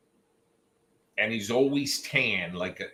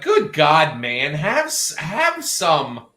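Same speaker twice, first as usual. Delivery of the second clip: loud, higher-pitched mock protest; mock exasperation; 5 semitones higher, 7 dB louder, slower than usual. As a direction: exclaim as if scolding him, hammed up. Acted protest at the man's shorts.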